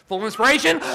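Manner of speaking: loud coaching call to inhale; shouted command. Shown as a demonstration. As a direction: call it out over the room. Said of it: "let's everybody do it this morning".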